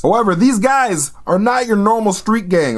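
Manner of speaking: ordinary delivery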